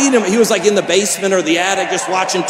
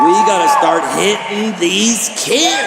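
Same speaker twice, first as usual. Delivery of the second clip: slow emphatic shout; 4 semitones higher, slower than usual. Acted. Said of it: "look you in the eye and say".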